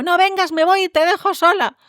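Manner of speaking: imitando a una madre que amenaza, voz muy aguda; amenaza de madre imitada. Retold or imitated. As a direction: amenaza actuada a una niña, tono alto sostenido. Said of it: "hemos escuchado muchas frases de miedo". (madre amenazante imitada)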